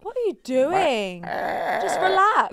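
slow laughing, coaxing voice. Real, not acted. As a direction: coax through laughter, slowly. Laughing, coaching the sound.

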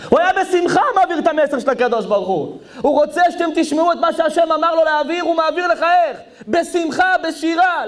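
high, rapid-fire exultant preaching; full-force preaching. Real, not acted. Preacher telling how Moses passed on God's message, full-force preaching. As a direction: race through it high and rousing.

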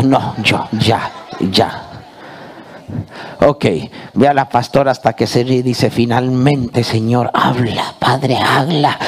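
usual speaking voice

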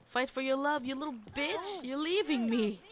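rally the screen like a fed-up fan; loud frustrated yelling. Real, not acted. A player yelling at her Sims to fight for love.

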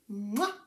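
mwah blown kiss; kiss sound. Real, not acted. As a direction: smack a quick kiss to a friend. Blows friend Laura a kiss.

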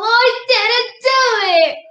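put-on high, loud child's denial; storybook girl crying out innocence; princess's shout. A narrator's reading. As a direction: shout it while running off. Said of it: "shouted the little princess, running away".

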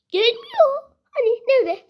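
real disbelieving exasperation; exasperated. Real, not acted. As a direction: throw up your hands in disbelief. Exasperated, monster won't come.

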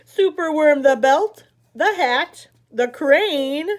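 plain reading voice

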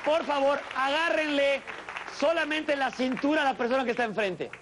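high-pitched pushy party host; hammed-up high party emcee ordering everyone to dance; party animator. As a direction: boss the crowd around like a cheesy animator. Plays party animator for many lines.

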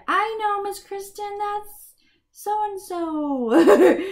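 put-on high, slow, playful kid voice with a laugh; child voice. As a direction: sing it out like an eager pupil. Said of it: "you can just look at these and say".

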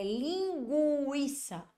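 LINGUIÇA stretched out, GU said as GW; U pronounced. Shown as a demonstration. Teacher proves the U is pronounced, slow exaggerated word. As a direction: draw out "linguiça" with a clearly sounded U. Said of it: "eu pronunciei o som do U".

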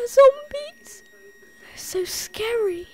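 high squeaky mock-scared voice; mock fright.

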